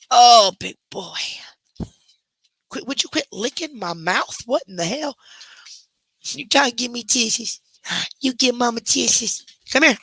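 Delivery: high, cooing pet voice; sing-song talk to a pet. Real, not acted. Cooing at her dog.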